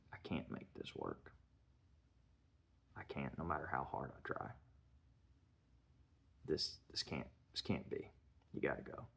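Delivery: low, soft, halting quote; hushed retold plea. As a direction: drop the voice right down, defeated. Retold tearful breakup in film recap.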